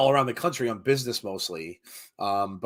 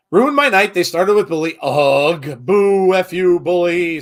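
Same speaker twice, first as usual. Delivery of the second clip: loud mock booing; 5 semitones higher, 10 dB louder, slower than usual. Reading angry chat comments.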